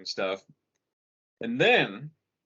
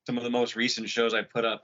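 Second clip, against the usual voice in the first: ordinary talk, nothing marked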